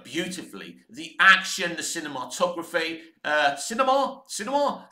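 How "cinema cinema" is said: higher, tentative try at "cinema"; "cinema" tried out, pitched up like a question; testing pronunciation. Shown as a demonstration. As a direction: sound out "cinema" as if unsure how it goes. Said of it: "that's how you say it".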